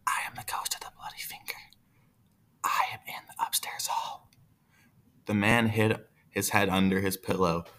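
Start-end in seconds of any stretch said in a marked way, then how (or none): 0.1-4.3 fast, soft ghost whisper; quick eerie ghost whisper; ghostly whisper. Acted. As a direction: whisper it, drawing nearer. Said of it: "hear the ghost coming closer".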